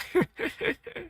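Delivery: very high squeaky burst of laughter; high wheezing laugh demo. Shown as a demonstration. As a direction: let out a shrill gasket-blowing laugh. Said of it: "blow a gasket kind of laugh".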